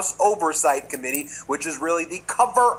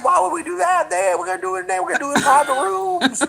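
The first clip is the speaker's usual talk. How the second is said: high, loud flustered stammer; mock a squirming congressman, pitched way up; mocking Comer; 5 semitones higher, 3 dB louder than usual. Host mocks Comer dodging a public hearing, high and flustered. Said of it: "And Comer's like".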